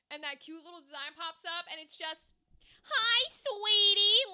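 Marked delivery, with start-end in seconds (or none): 2.9-4.2 high, loud, drawn-out greeting; put-on sweetie voice. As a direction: belt out a sugary character greeting. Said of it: "if I did that voice".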